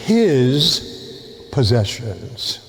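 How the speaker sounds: slow, stretched "his possessions" leaning on "his"; stress on his. Shown as a demonstration. Priest stresses "his" for the congregation. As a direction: lean hard on "his" in "his possessions". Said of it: "with the emphasis on his".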